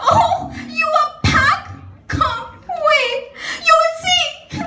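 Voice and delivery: high, sobbing complaint; crying. Acted. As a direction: wail through tears to the one just back. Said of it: "Why are you crying?".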